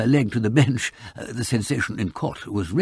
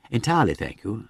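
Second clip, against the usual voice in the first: plain reading voice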